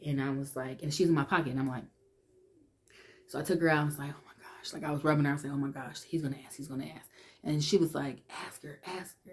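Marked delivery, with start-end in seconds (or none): 4.1-4.7 a barely voiced gasp of dread; panicked whisper. Panicking alone with her toy. 5.5-7.1 much softer, fretful murmur; anxious whisper. Retold or imitated. Panicking alone with her toy. 8.5-9.3 hushed, urgent egging on; whispering. Retold or imitated. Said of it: "I could hear them whispering".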